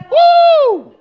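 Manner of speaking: high, loud "woo" cry; loud whoop. Real, not acted. Frustrated exclamation recalling noisy kids.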